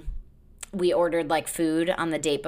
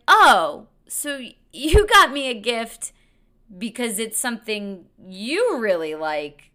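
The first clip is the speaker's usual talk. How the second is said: high, slow, drawn-out mock realisation; sarcastic disbelief; 6 semitones higher, 3 dB louder, slower than usual. Mocking her date's brie gift, slow sarcastic drawl. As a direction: spell out his logic with slow sarcasm.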